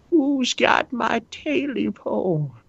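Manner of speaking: high, slow eerie creature call; creepy creature voice. Acted. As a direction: moan it like a monster in the dark. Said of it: "He sounds like the leprechaun".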